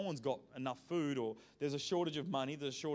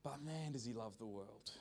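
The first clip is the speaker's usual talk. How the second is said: much softer, drawn in close; hushed awe; 3 semitones lower, 9 dB quieter than usual. Real, not acted. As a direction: drop to a tender near-murmur. Preacher softens on God's love.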